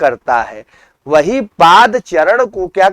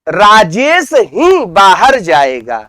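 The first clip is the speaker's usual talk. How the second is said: slow, with "ही" swung up in pitch; stress on ही; slower than usual. Shown as a demonstration. Teacher demonstrating an emphatic particle, stress on "ही". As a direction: lift and lengthen "ही" after "Rajesh". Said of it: "किसी शब्द पर बलाघात किया जाए".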